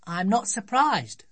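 put-on high-pitched child's voice, unimpressed; matter-of-fact little girl. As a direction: say it as a know-it-all daughter. Child scolds father on floor.

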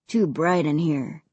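put-on a low, somewhat lugubrious boy ghost; hint of a low ghostly voice; lightly voiced dead kid. A narrator's reading. Dead boy speaks in story.

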